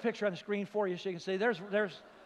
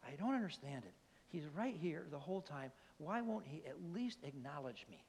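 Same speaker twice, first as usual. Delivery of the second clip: soft, lower, hint of a puzzled old man; lightly voiced elderly man; 3 semitones lower, 10 dB quieter than usual. Ninety-year-old at the next table wondering aloud, softly imitated puzzled hurt. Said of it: "this nine-year-old guy".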